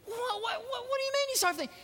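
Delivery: very high, stammering disbelief; shocked. Acted. Johnny learns Grandma saw everything, shocked. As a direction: stammer in squeaky astonishment.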